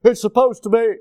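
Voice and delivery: high, loud mock of a sexist saying; preacher parodying what idiots say; mocking the culture. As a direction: sneer it out like an idiot would say it. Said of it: "We say".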